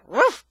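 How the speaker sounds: high, loud single doggy ruff; dog bark. A narrator's reading. Dog greeting the boy on the mower, imitated bark. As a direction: imitate one punchy bark. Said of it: "gives a little".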